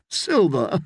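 amused, incredulous echo; laughing disbelief. A narrator's reading. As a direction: echo SILVER as if it were absurd. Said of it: "Sir Hyle laughed".

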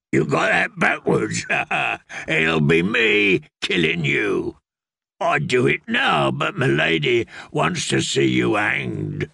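rough mocking killer with a laugh; the Hound taunting the prisoner he means to hang; menacing hound. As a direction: sneer it down at your captive. The hound mocks Brienne.

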